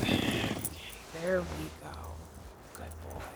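very soft, tender praise. Acted. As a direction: coo it gently to a tired dog. Dog resting after the hike.

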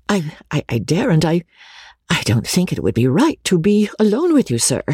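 put-on agitated young woman, halting; great agitation. A narrator's reading. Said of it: "seemingly in great agitation".

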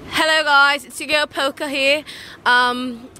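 put-on British vlogger patter; British accent. Plugging her channel to viewers, British accent. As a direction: greet the fans in a performed British accent. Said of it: "he wants me to talk in a British accent".

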